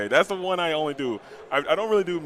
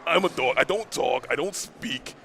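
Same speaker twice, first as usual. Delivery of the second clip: put-on lower, Yonkers accent dog introducing himself; Yonkers accent Pluto; 3 semitones lower than usual. Pluto introducing himself as a Yonkers deli worker, New York accent. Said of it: "he's from Yonkers".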